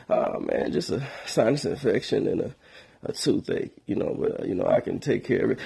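whispery, low, fading sick voice; faint sick voice. Pretend. Telling the friend about the infection in a feeble whisper. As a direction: sound too weak to talk much. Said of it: "I answer with my sick voice".

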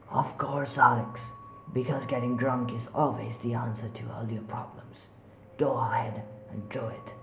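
soft sarcastic eerie voice; mock him quietly; ghostly taunt. Voices mocking Alex.

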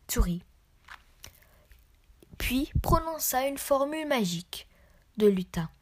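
usual speaking voice

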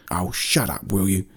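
lightly voiced grumpy creature; mildly testy creature voice; irritated Gargan fan. A narrator's reading. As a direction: brush off the apology as a cross creature.

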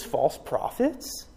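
ordinary talk

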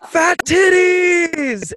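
high, loud, long-held holler; acted whooping shout; celebratory yell. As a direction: bellow it in joy, dragging it out.

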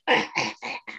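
short breathy mock coughs; imitated coughing. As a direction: fake a string of little coughs. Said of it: "coughing constant".

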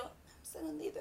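un segundito susurrado; susurro. Retold or imitated. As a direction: susurra bajito, casi sin voz. Susurra lo que dijo a clienta. (susurro imitado pidiendo un segundito)

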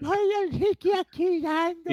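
very high slow falsetto; high squeaky voice. Pretend. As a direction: squeak it slowly, clowning. High joking voice.

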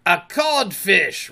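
slow, high, loud jibe; taunting reply. A narrator's reading. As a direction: draw it out as an insult. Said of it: "replied the voice".

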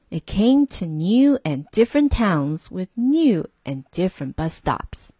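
plain reading voice

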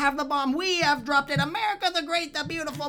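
put-on high, loud old newsreel announcer; fifties radio voice. Mocking postwar America as a sensational radio announcer. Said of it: "the classic radio".

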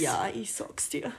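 a hushed, unvoiced whisper of "ich sag's dir"; slow breathy aside, half joking. Whispering back to her co-host in mock Bavarian.

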